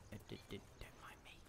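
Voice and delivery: fast stammered whisper; nervous mock whisper, fast; sneaky whisper. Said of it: "we gotta be sneaky imp".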